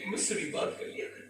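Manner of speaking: soft, breathy whisper; a lonely mother's faint request; whispered plea. Retold or imitated. Old mother to her busy son, whispered plea.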